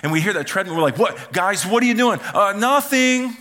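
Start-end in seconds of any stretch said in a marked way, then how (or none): 1.0-2.2 put-on higher, louder call to the kids; parents calling down. Parents upstairs hearing the treadmill, calling out suspiciously. 2.3-3.4 put-on high, loud, drawn-out child's denial; kids caught out. Kids answer parents about the treadmill, feigning innocence.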